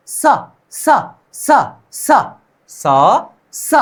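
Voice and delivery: a strong, hissed "स" repeated one by one; forceful s sound. Shown as a demonstration. Teacher drilling students on a forceful "स". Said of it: "थोड़ा सा जोरदार स".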